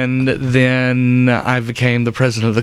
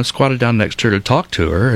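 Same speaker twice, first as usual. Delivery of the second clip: normal talking voice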